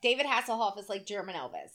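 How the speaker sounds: ordinary talk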